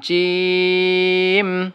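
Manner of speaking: জিম drawn out very long, three alif; long drawn jim. Shown as a demonstration. Teacher drawing out জিম for students. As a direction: stretch জিম slowly like a Quran teacher. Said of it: "এই জিম হরফটিকে আপনি কি করবেন? তিন আলিফ টান দেবেন".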